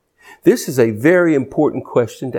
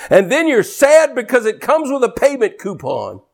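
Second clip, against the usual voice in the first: preaching at full force; 7 semitones higher than usual. Acted. Preacher warns the congregation about debt, fervent. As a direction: drive it at the congregation hard.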